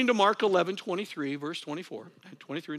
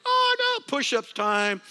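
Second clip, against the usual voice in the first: much higher, louder mock wail of dismay; mock dread; 9 semitones higher, 3 dB louder, slower than usual. Imitating him dreading his push-ups, much higher. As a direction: play the groaning husband facing his workout. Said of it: "He goes".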